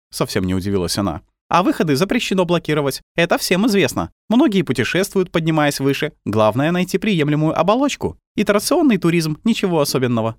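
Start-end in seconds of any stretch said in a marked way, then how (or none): 1.5-10.4 put-on high, matter-of-fact girl voice; girl character. A narrator's reading. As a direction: voice a calm, knowing little girl.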